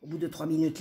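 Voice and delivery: plain reading voice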